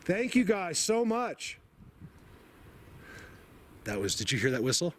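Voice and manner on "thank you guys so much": high, slow and choked up; crying thanks; tearful thanks. Real, not acted. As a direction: overcome with emotion, voice cracking. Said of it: "I'm really losing it".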